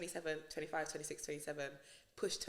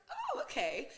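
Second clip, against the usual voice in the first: mock surprise; 9 semitones higher, 4 dB louder, slower than usual. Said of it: "I was like".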